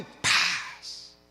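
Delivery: loud drawn-out mouth-made smack; imitated belt crack. Father showing the folded belt before discipline, imitated loud crack. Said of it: "Lo doblaba y".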